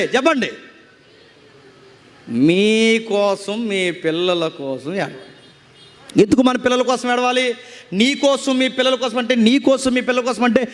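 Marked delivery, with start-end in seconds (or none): 2.3-6.2 very slow, stretched words sinking low; slow solemn quote. Retold or imitated. Preacher quoting Jesus to weeping women, slow and solemn. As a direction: draw each word out, dropping at the end.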